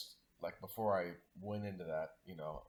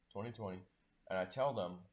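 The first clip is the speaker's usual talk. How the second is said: usual speaking voice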